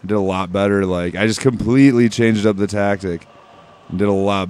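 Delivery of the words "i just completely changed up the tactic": normal talking voice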